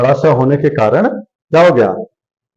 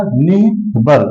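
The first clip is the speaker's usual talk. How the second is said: slow sounded-out word; much slower than usual. Shown as a demonstration. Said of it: "देखिए, निर्बल हो गया".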